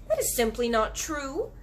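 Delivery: put-on indignant little girl contradicting; angry cry. Girl arguing with the giant, angry. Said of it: "Sophie cried angrily".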